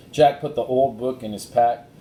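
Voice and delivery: ordinary delivery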